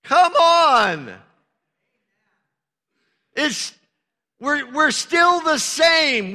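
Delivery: fervent preacher rising to a peak; preaching at full force. Acted. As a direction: drive the point home to the congregation.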